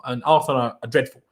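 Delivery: ordinary talk, nothing marked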